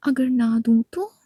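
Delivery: loud, slow challenge; teasing friend refusing to hand it over; defiant taunt. Acted. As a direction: dare her to do something about it. Khushbu taunts Natasha.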